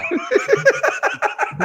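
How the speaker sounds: high, bursting laugh; loud high cackle; big laugh. Real, not acted. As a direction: crack up at a friend's jab. Laughs at marriage joke.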